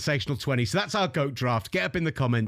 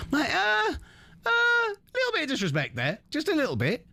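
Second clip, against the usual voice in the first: mock outrage; 14 semitones higher than usual.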